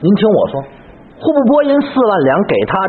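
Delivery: ordinary talk, nothing marked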